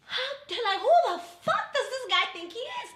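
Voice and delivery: high, loud, drawn-out retold outrage; indignant outburst. Date is swerving her call, indignant outburst. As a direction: blurt it out in incredulous indignation.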